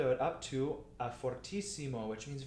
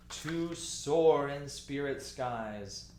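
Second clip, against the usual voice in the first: very slow, "soar" and "skies" stretched long; stately, elongated recitation ending on a long "skies"; expressive phrasing; 4 dB louder, much slower than usual. Shown as a demonstration. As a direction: draw out "soar" like a long held note. Teacher demonstrates expressive choral phrasing.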